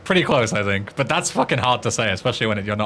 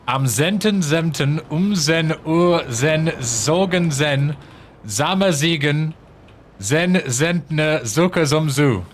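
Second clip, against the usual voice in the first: sing-out German tongue twister; attempted German; much slower than usual. Shown as a demonstration. Streamer winging a German tongue twister, attempted German. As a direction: push through the German without knowing it. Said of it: "I'm just fucking winging it".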